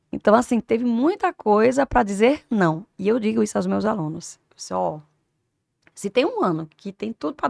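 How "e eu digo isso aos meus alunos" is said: usual speaking voice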